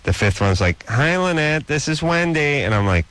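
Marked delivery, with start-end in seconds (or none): 0.9-2.6 high, lilting phone-message voice; mock chirpy greeting in a raised voice; imitating female caller. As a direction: mimic a woman leaving a message, pitched up.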